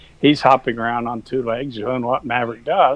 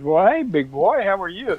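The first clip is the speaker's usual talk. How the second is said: cow voice; 7 semitones higher than usual. Retold or imitated. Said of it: "I don't know what cows say".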